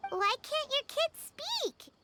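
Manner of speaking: high bubbly little companion; squeaky eager fairy chatter; cheerful tiny floating sidekick; chirpy sidekick voice. Acted.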